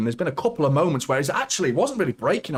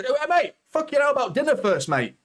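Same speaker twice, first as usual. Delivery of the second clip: higher, mock-indignant imitation of the fouled player; mock victim voice; 9 semitones higher than usual. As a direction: play the player being grabbed, jokingly offended. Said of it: "He tries to".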